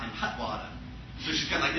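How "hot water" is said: low, soft Boston imitation; mom's R-less New England accent; Boston vowels, quietly shown; Boston-Providence accent. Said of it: "this whole crazy voice".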